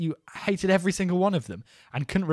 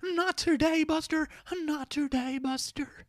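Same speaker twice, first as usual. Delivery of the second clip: mock Buster voice; 14 semitones higher than usual. Host ending the bit in a high, fading mock voice.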